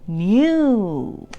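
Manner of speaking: usual speaking voice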